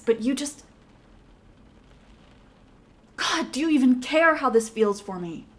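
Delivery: loud, escalating frustrated pleading; angry outburst. Acted. Heated argument with withdrawn partner.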